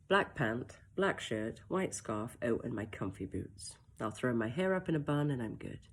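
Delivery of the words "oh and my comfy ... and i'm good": plain reading voice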